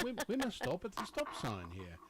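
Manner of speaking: high-pitched mock British voice; hammed-up British; British accent. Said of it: "talking to myself in British".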